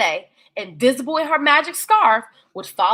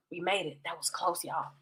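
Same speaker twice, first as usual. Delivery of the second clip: much softer, lower relieved aside; a quiet relieved aside to the kids; relieved hush; 7 semitones lower, 17 dB quieter than usual. Acted. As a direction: breathe it out in relief, hushed. Narrator dog relieved aside.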